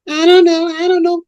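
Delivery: put-on high-pitched girl's voice, protesting ignorance; girls answering the pimp. Girls answering their boss, high-pitched. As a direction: play a girl answering up high.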